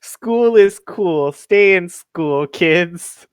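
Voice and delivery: slow, preachy public service voice; a lightly voiced after-school special; mock PSA. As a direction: spell it out like a cheesy school ad. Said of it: "mock PSA slogan".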